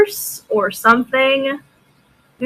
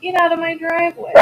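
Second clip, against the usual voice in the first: higher, fast mock scolding; mock shooing; 7 semitones higher, 3 dB quieter, faster than usual. Real, not acted. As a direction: playfully shoo them off, fast. Shooing a stranger off driveway.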